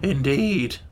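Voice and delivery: mildly indifferent Gump; understated indifference; indifferent murmur. A narrator's reading. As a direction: toss it off without interest. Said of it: "murmured the Gump indifferently".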